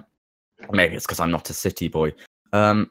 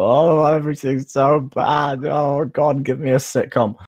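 melodramatic whining; 6 semitones higher, slower than usual. Retold or imitated. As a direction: wail like a self-pitying actor. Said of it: "just go".

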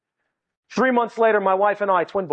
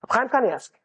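ordinary talk, nothing marked

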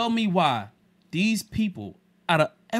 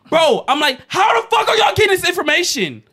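much higher and louder, incredulous fury; outraged retold rant; 12 semitones higher, 10 dB louder than usual. Telling friends how he confronted the cashier, high and loud in outrage. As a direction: yell it at the cashier in disbelief. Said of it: "So I'm like".

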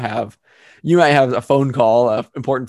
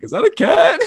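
high, loud, laughing disbelief; mock-incredulous high question; baffled onlookers; 14 semitones higher, 5 dB louder than usual. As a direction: imitate startled colleagues asking in amazement. Meeting attendees puzzled, acted out.

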